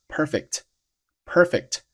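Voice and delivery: "perfect" with the c held, not released; unreleased stop. Shown as a demonstration. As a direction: slowly model "perfect" with a silent k before t. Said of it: "前面的爆破音要失爆".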